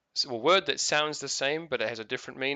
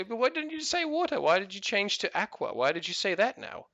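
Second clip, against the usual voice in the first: high-pitched mock exasperation at his wife; exasperated questioning; 8 semitones higher than usual. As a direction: retell it as a baffled, high-pitched protest. Retold quarrel with his wife.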